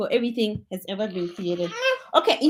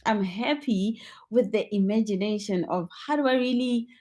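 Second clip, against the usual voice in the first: usual speaking voice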